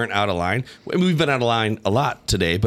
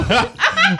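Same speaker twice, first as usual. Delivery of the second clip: high laughing question. Real, not acted. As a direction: giggle while asking. Laughing at grabbing items.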